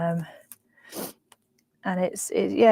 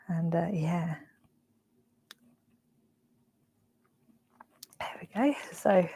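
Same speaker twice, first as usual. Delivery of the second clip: whispering; 3 semitones lower, 8 dB quieter than usual. Real, not acted. Quiet whispered trailing.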